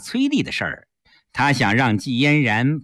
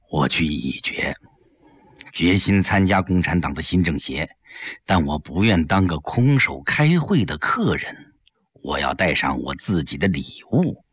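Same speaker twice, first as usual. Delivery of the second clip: put-on lower, measured old leader's voice; elderly statesman; 8 semitones lower than usual. Li Jishen confiding to Yang Jie, low and measured. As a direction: speak like a senior statesman confiding.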